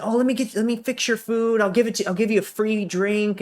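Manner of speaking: high-pitched, eager-to-please server; imitating over-friendly waiter. Waiter fussing over a customer, high mock-friendly voice. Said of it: "acts like they're your best friend".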